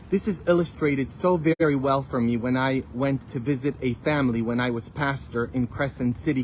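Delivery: ordinary talk, nothing marked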